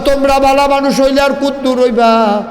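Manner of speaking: high, chanted taunt; acted insult. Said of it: "তেমন জঘন্য একটা গালি দিছে".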